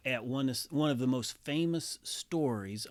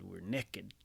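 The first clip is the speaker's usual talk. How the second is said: Texan drawl; 6 semitones lower, 6 dB quieter than usual. Retold or imitated. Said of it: "if Adam and Eve had been Texans".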